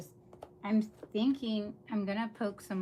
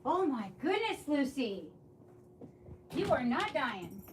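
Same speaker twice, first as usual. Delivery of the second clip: high, swooping exasperation at the dog; fed-up, sing-song reproach; exasperated scolding; 4 semitones higher than usual. Real, not acted. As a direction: tell off a melodramatic pet. Scolding the dramatic whining dog.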